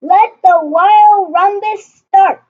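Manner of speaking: high, loud, gleeful proclamation; excited cry. Acted. Max starting the rumpus, a loud gleeful cry. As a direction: shout it like a king starting a party. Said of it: "cried Max".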